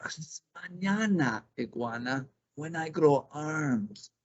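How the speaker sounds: slow, drawn-out promise; easygoing snake putting things off; lazy snake. Acted. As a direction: stretch it out like a lazy serpent. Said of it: "Culebra said".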